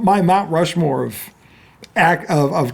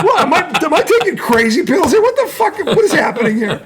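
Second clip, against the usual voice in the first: high-pitched mock fury, half laughing; mock outraged teacher; 8 semitones higher than usual. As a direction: squeak out the teacher's disbelief, laughing through it. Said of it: "almost blows the gasket".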